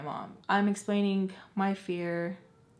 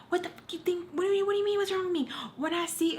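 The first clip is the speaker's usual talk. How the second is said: very high, outraged mom impression; screech-pitched mock tirade, cut off midway; cussing mom; 12 semitones higher, faster than usual. Mom cussing out kid who talked back, high outraged mimicry. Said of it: "she starts freaking cussing me out".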